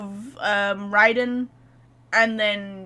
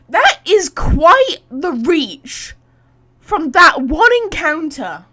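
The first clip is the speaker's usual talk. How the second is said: loud, slow, mocking "that is quite the reach"; sarcastic loud drawl; 3 semitones higher, 10 dB louder, slower than usual. Real, not acted. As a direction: stretch it out louder, scoffing at the plot. Sarcastic jab at game logic.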